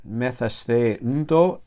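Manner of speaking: slow, syllable by syllable endings; slow recital of endings. Shown as a demonstration. Teacher reciting Greek endings slowly. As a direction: spell out each ending slowly. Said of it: "estas son las mismas desinencias".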